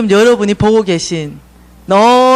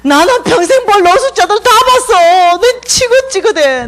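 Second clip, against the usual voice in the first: whiny refusal; 10 semitones higher, 3 dB louder, slower than usual. Retold or imitated. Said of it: "내가 이렇게 말했어요".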